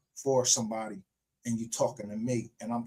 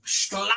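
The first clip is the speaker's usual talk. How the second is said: imitated whip crack; 6 semitones higher than usual. Retelling King Kong being whipped, sudden whip sound. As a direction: act out the lash with your voice.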